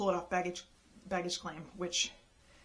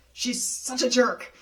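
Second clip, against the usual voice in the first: slow disgusted; 4 semitones higher, 6 dB louder, slower than usual. Retold or imitated. Agent venting about attendant.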